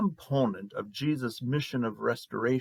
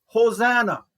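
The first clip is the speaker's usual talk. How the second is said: raised, high-pitched acclaiming cry; crowd's shout; 9 semitones higher, 8 dB louder than usual. Retold or imitated. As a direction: call it out loud like a cheering crowd. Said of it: "even though they shouted".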